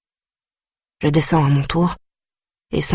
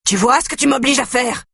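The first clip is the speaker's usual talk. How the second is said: higher-pitched yell; 9 semitones higher than usual. Acted. Brother yells at his sister while beating her, higher yell. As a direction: shout the blame at her. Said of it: "hurle Nourayed".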